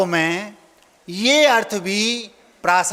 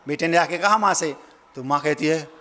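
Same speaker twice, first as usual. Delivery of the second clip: ordinary talk, nothing marked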